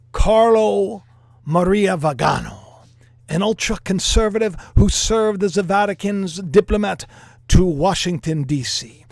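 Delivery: loud, hammed-up Italian accent. Vlogger presenting the archbishop to viewers, in a put-on Italian accent. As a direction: put on a big Italian accent, drawing out key words. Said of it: "Do you like my Italian accent?".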